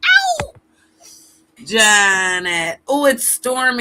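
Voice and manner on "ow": sharp squeal, very high; high falsetto yelp. Pretend. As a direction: yelp like a pop star's ow. [name] style yelp.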